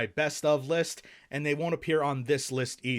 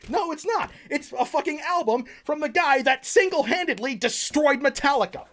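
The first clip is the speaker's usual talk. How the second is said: angry rant; 10 semitones higher than usual. Acted. Vlogger to viewers over the wrong vinyl album, furious high rant. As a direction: rage about the wrong vinyl in the box. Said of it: "Sorry for that rant earlier".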